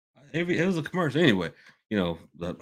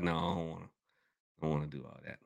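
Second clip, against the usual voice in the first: ordinary delivery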